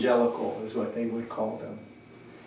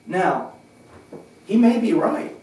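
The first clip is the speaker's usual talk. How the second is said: loud, higher-pitched playful concession; playful crowd address; 4 semitones higher, 7 dB louder than usual. Retold or imitated. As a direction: project it good-humouredly to a whole crowd. Retold address to a park crowd.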